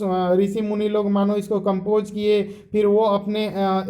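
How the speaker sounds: ordinary talk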